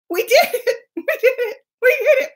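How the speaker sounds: high, choked-up cries of triumph; happy tears breaking through; tearful joy. Real, not acted. Celebrating the live stream success.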